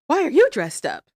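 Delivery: imitating high, loud nonsense outburst; incoherent rambling. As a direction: blurt it out high like someone babbling. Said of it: "starts talking incoherently immediately".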